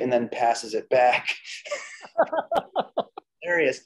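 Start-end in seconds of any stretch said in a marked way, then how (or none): 2.2-3.2 short high real laugh. Real, not acted. Recalling the business card joke, short real laugh. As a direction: break into laughter.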